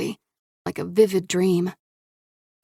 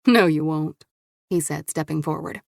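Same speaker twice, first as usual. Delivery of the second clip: ordinary delivery, nothing marked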